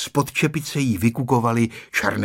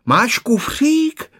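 shouting; 11 semitones higher, 5 dB louder, much slower than usual. A narrator's reading. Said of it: "křičela maminka na nástupišti".

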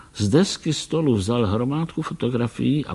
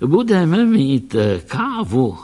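slow, louder, delighted announcement; gleeful; 5 semitones higher, 5 dB louder, slower than usual. Acted. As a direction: savour the coffee to come. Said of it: "radoval se ten malý pán". (malý pán se raduje, pomalu a hlasitěji)

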